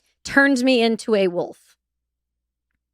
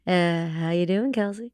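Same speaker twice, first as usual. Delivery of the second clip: shaman's tone; 3 semitones lower, 6 dB quieter than usual. Retold or imitated. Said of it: "he's got this great tone in his voice".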